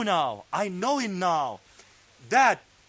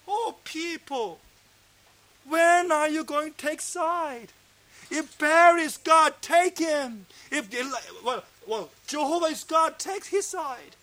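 higher, impassioned call to the people; fiery appeal; 6 semitones higher, 3 dB louder than usual. Retold or imitated. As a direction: urge them hard to choose a side. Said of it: "with burning fire from his heart".

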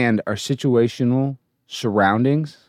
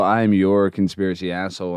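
usual speaking voice

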